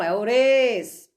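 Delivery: É o res bem devagar; sílaba arrastada. Shown as a demonstration. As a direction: estique cada som de res. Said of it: "Qual é o segundo pedacinho". (professora dizendo o res bem devagar)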